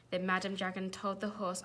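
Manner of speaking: plain reading voice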